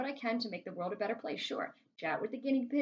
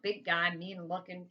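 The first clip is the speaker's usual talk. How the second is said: plain reading voice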